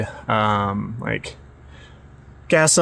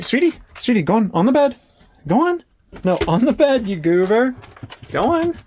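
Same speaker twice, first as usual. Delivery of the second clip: much higher sing-song coaxing; high coaxing pet talk; 10 semitones higher than usual. Real, not acted. Urging her dog onto the bed in much higher coaxing voice. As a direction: coax the dog up high and bright.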